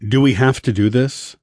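usual speaking voice

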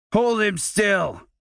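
lightly voiced bully barking; commanding. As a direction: demand they pin him.

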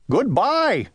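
high parting call. Acted. Owl to departing Winter, calling out. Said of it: "called Owl".

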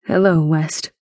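lightly voiced grandfather; grandfather's greeting. Grandfather greets stunned West, understated greeting.

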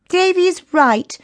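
put-on high, drawn-out girlish protest; crying out in protest. A narrator's reading. Chrissy scolds Billy, high crying protest. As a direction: plead in a high child's voice. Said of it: "cried Chrissy".

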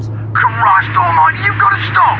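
urgent plea. Acted. Bill pleads with Sally to stop the car. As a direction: beg her urgently to pull over.

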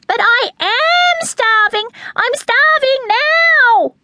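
high, drawn-out chick wail; squealed protest. A narrator's reading. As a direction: wail it like a starving baby bird. Chick's screaming protest in story.

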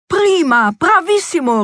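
high, exultant cheer; delighted cry. A narrator's reading. Queen applauds the guest list, delighted cry. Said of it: "rief die Königin".

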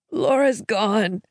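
slow, gasping grief as the husband; gasped. A narrator's reading. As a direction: gasp the name out slowly, grieving. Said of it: "he gasped".

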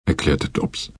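usual speaking voice

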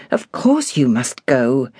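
usual speaking voice

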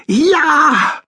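high, loud joyful cry; called out. A narrator's reading. Hamster answers his brother on arrival, high elated call. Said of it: "rief Krümel".

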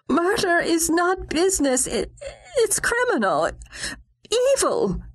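high, shaking outrage; outraged protest. Acted. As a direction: cry out in disbelief.

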